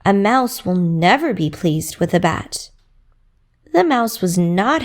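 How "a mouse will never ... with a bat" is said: ordinary delivery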